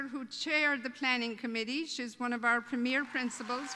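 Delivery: ordinary talk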